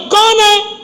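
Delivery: high, loud angry yell; loud shout. Acted. Pharaoh yelling at the door, loud shout. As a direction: yell it from inside the room. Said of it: "جب اس نے زور سے چیخا".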